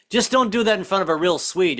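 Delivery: usual speaking voice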